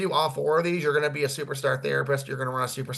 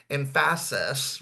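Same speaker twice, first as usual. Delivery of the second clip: "emphasis" said very slowly, each syllable clear; slowed emphasis; much slower than usual. Shown as a demonstration. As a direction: drag "emphasis" out slowly to show how it's done. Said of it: "you wanna slow your speech down".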